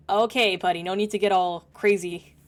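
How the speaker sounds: loud, half-laughing call to calm down; loud reaction. Real, not acted. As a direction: tell the character to chill, loudly. Streamer reacts to character's outburst.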